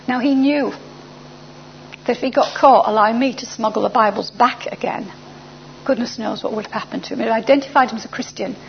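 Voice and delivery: usual speaking voice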